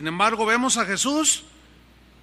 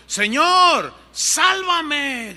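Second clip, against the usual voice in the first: loud, high plea to be saved; mock cry for help; 7 semitones higher, 6 dB louder, much slower than usual. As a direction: call out like a sinking man. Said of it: "gritó diciendo".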